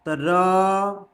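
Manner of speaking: र stretched very long and slow; drawn-out र. Shown as a demonstration. Teacher stretching र for students. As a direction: hold the र sound out long. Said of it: "पूरा एकदम खींच के बोलेगा".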